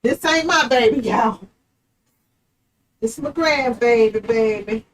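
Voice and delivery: usual speaking voice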